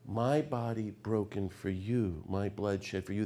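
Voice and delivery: slow, soft, reverent quote; tender Jesus words. Retold or imitated. Preacher restoring Jesus's true words, soft and slow. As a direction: say it gently, like a gift. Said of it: "No, friends".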